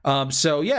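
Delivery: normal talking voice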